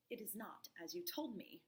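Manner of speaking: hushed whispered accusation; a wife's hurt whisper. Acted. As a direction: breathe it out, barely voiced. Wife's quiet accusation, unvoiced.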